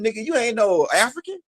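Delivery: high, mocking voice scoffing at him; high scornful put-down; mocking Africans. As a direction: pitch it way up like a scornful elder dismissing him. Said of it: "You know what they told him?".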